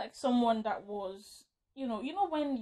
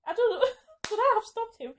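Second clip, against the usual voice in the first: high-pitched words through real laughter; laughing; 11 semitones higher, 5 dB louder than usual. Real, not acted. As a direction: ask it while cracking up. Helpless laughter while telling the story.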